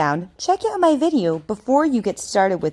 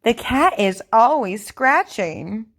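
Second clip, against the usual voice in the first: over enunciating. Shown as a demonstration. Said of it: "Repeat after me".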